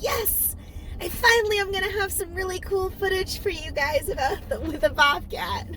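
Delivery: high thrilled squeal; excited. Retold or imitated. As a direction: gush with delight. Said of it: "I got so excited".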